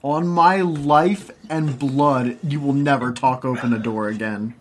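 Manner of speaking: slow, solemn oath; mock solemn vow. Real, not acted. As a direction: swear it slowly like a vow.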